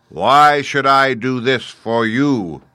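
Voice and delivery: loud, high, very slow challenge; imperious, drawn-out giant voice; booming Great Head. A narrator's reading. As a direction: boom it slowly like a giant oracle.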